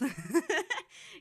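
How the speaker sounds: quick high laugh; amused laugh at the grind. Real, not acted. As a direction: let a real laugh slip out high. Laughing about hating emails.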